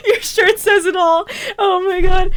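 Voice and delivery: mock it while laughing hard; laugh-choked cry; laughing shout. Real, not acted. Laughing mockery of ad.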